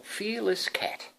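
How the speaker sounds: ordinary delivery, nothing marked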